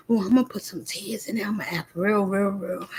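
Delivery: whispered, sneering imitation of someone faking tears; hushed mock impression of a fake crier. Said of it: "because you could cry".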